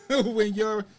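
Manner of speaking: loud, high delivery breaking into laughter; laughing. Real, not acted. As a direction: crack up loudly while talking. Teasing remark, breaking into laughter.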